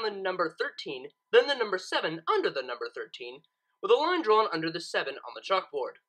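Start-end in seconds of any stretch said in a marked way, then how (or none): none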